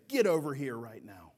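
acted sharp order to a dog; mock scolding command. Owner calling a naughty dog back, mock scolding. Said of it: "point your finger at the dog and say".

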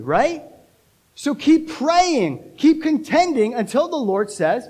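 high, urgent pulpit exhortation; full-force preaching. Real, not acted. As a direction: push the congregation to keep at it.

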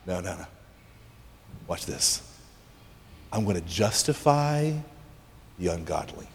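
low, soft, with suspenseful pauses; hushed, deliberate reveal; quietly confident God. Acted. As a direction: let them in on a secret plan, hushed. God quietly reveals his plan.

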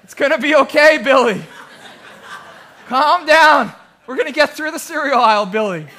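loud, high, hammed-up frazzled dad; mock-frantic self-calming. Frazzled father orders himself to calm down, mock-frantic and loud.

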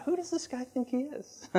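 mildly mock an indignant bystander; lightly voiced doubter in the crowd; skeptical heckler. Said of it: "one of those guys in the crowd saying".

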